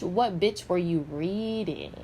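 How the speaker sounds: ordinary talk, nothing marked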